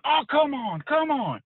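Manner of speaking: frustrated fan begging the team; wife groaning at the game. Retold or imitated. Wife watching the football game from the bedroom, exasperated high plea. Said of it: "I hear my wife going".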